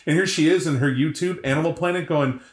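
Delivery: ordinary talk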